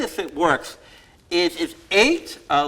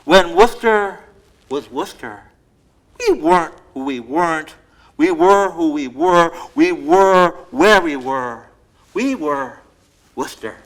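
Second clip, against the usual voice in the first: bold, punchy alliterative recital, louder; poem reading; 4 semitones higher, 10 dB louder, slower than usual. Acted. Poet reciting her Worcester poem to the audience, emphatic and loud. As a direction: hammer out every W like a performance poet.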